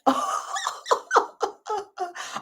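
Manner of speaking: sudden high laugh. Real, not acted. Laughing at a viewer's comment, high and sudden. Reading a surprising comment live.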